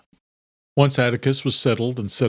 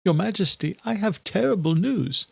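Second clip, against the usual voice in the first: higher anxious servant; breaking terrible news, higher; Adela's voice; 9 semitones higher than usual. A narrator's reading. Adela brings bad news.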